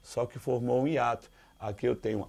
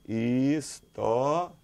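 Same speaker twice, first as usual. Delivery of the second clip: very slow, each syllable set apart; much slower than usual. Shown as a demonstration. Teacher showing students another way to split the word, syllable by syllable. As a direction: break the word "História" into separate drawn-out syllables. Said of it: "ela pode ser separada assim também".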